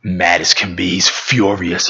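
ordinary talk, nothing marked

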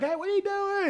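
high, squeaky mock voice of a puzzled soldier; squeaky retold challenge; mocking squeak. Cadets questioning the gun stripping.

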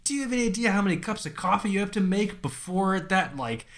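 high incredulous question; incredulous dad. Retold or imitated. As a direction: voice the baffled dad. Dad's skeptical question retold.

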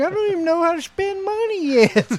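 put-on whiny little kid, high and loud; high-pitched kid voice. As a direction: squeal it like a hurt child. Voicing himself as child.

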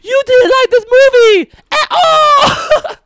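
slow, very high, loud character impression; hammed-up accented squeal, drawn out; Mantis. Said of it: "That's how Mantis sounded".

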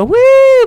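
very high, loud "woo"; mock cheering whoop. Imitating all the ladies in their team-up scene, mock whoop. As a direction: whoop like a gang of heroines, hammed-up. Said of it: "all the ladies go".

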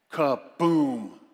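a loud, booming "Kaboom!". Acted. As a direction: land the punchline like an explosion. Punchline after the advice.

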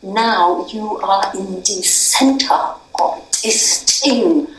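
slow, lightly imitated Hungarian accent. Father telling his daughter she is at the centre, in a lightly imitated Hungarian accent. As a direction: slowly mimic her father's Hungarian accent, looking her in the eye. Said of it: "in his Hungarian accent".

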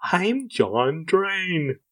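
high slow cop voice. Acted. Co-host offering a second voice option, in a high very slow put-on voice. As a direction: stretch every word out high and slow. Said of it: "pick whichever voice you like more".

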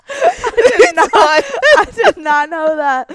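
words squeezed out through real laughter; laughing. Real, not acted. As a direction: laugh while you say it, pitch up high. Cracking up at the bio.

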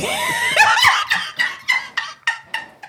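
high loud burst of laughter; loud shrieking laugh. Real, not acted. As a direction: explode into a shrill cackle.

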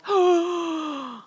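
sharp high gasp of shock. Retold or imitated. Couple watching the second plane hit, gasping. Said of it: "And we're like".

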